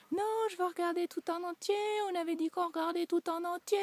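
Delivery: mock whiny child refusing the bath. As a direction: mimic a stubborn kid refusing. Son protests, whiny child voice.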